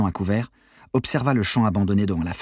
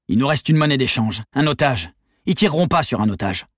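raised, urgent pitch; feverish insistence; 5 semitones higher, 4 dB louder than usual. A narrator's reading. Cornered leader selling hostage plan, feverish insistence. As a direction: press the mad plan on them.

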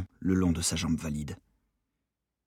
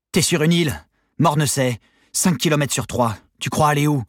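loud, high, cutting defiance; lashing retort; 8 semitones higher, 7 dB louder than usual. A narrator's reading. Wounded guard defies armed escapee, lashing retort. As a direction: throw it back hard at the gunman. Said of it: "Sa réponse cingla".

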